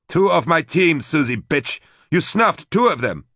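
high, loud raging yell; furious shout. A narrator's reading. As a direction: scream it in rage at her. Leol furious on the screen.

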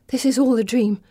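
ordinary delivery

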